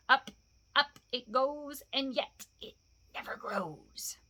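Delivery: creeping rise then a hushed whisper; sly creature savouring its riddle; Gollum riddling. Acted. Gollum tests Bilbo with a riddle, sly hushed hiss.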